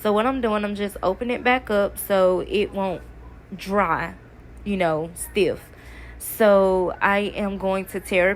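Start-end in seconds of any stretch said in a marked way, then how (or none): none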